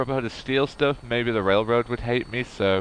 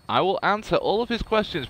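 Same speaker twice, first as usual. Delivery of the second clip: higher, playful parroting of an order; mock-obedient echo; 7 semitones higher than usual. Gamer repeating a game character's order, mock-obedient. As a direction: parrot the order back in a lilting tone.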